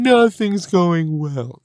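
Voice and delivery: slow, dragged-out mock moan; drawn-out pessimist moan; mock gloomy whine. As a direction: play the self-pitying complainer. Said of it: "you have to refrain from going".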